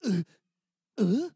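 choked uh sobs between pauses; mock broken prayer; wordless sob prayer. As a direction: pray with nothing but sobs. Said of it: "powerful prayer to God that went something like this".

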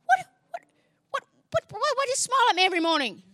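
high, fast stammering "what, what"; woman demanding why he smiles, high and fast; suspicious rapid questioning. Retold or imitated. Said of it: "She goes".

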